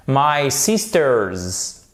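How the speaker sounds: very slow "sisters", final s voiced as Z; clearly sounded S. Shown as a demonstration. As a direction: draw out "sisters" and finish on a clear Z. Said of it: "prononcez bien ce S".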